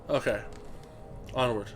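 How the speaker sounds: usual speaking voice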